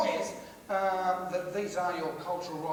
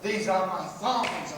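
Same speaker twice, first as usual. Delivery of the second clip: slow, firm and loud, in usual pitch; slow proud declaration; 5 dB louder, much slower than usual. Acted. Comic owning his broken-in thongs, slow and proud. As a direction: declare it proudly, drawing out the words. Same boast repeated, settling down.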